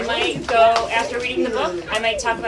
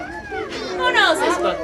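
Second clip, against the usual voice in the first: much higher, slow, playful question; reading to a toddler; much slower than usual. Acted. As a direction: lift the voice to excite them.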